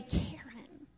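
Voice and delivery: low, soft voice calling a name; God calling softly. Acted. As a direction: voice God tenderly saying her name. Voicing God calling her name.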